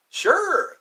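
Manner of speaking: high, loud burst; excited. Real, not acted. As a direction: blurt it out eagerly. Sudden excited reply.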